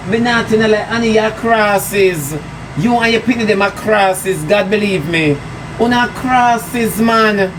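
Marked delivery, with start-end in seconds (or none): 5.8-7.5 slow, dragged-out scorn; drawn out scorn. Real, not acted. Scorning the neighbours, slow and dragged out. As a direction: stretch it out with contempt.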